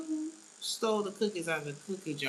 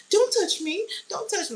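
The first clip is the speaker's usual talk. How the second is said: shrill panicked shriek, much higher and louder; Karen shriek; 8 dB louder than usual. Retold or imitated. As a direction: shriek like an entitled Karen who's being touched. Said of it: "she really started doing the Karen shit".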